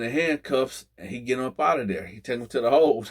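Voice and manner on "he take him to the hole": ordinary talk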